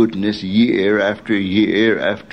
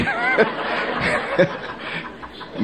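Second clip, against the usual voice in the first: high airy chuckle at himself; soft real laugh; breathless laugh; 11 semitones higher, 5 dB quieter than usual. Real, not acted. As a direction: laugh off the tall tale. Laughing at his own joke.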